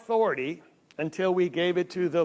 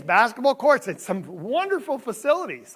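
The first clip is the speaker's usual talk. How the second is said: usual speaking voice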